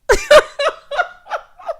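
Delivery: high burst of real laughter; shrieking laugh; loud laugh. Real, not acted. Laughing at the story.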